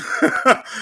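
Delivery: short high unvoiced laugh; a quick high chuckle. Real, not acted. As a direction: burst out laughing. Laughs at doctrine line.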